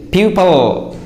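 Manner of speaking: PUPIL as "PYOO-pul", weak second vowel; correct pronunciation. Shown as a demonstration. Teacher models the word for learners, pupil pronounced "PYOO-pul". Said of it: "This is the correct pronunciation".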